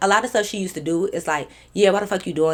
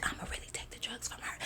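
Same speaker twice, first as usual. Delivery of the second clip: whisper; 21 dB quieter, faster than usual. Retold or imitated. Whispering aside to the girl.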